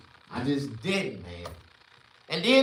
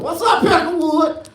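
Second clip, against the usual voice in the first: higher, louder mocking greeting with a laugh; cackling mock hello; laughing taunt; 12 semitones higher, 10 dB louder than usual. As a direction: call it out laughing and teasing. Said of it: "I address all of them".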